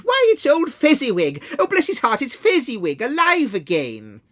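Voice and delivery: high, loud, overjoyed cry; great excitement. Acted. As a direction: burst out like seeing an old friend alive. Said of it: "Scrooge cried in great excitement".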